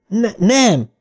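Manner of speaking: high, alarmed protest; a child's frightened protest. A narrator's reading. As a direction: cry out no in a panic. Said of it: "tiltakozott Eddie".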